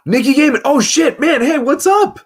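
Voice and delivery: thrilled to see a friend drop in; excited greeting. Real, not acted. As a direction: gasp then a high, loud hello. Surprised greeting a viewer joining.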